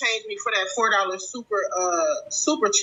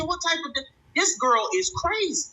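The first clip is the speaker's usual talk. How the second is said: stunned disbelief; 9 semitones higher than usual. Real, not acted. Reacting in disbelief to video.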